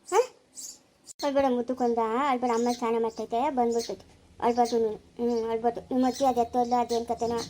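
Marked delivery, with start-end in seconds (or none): 1.2-7.4 put-on low, soft hushing of a crying child; calm the little one gently, voice dropped low; soothing a baby. (ಮಗುವನ್ನು ಮೆಲ್ಲಗೆ ಸಮಾಧಾನಿಸುವ ದನಿ)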